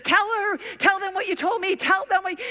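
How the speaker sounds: high, urgent repeated tell them; urgent pleading. Retold or imitated. Brothel owner urges the girl to tell her story. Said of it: "this owner says".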